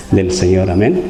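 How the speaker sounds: ordinary talk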